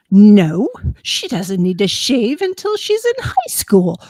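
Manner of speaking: loud, high-pitched outraged protest; mock-outraged father putting his foot down; indignant dad. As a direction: blurt it out, scandalized and rising. Retelling dad's indignant refusal.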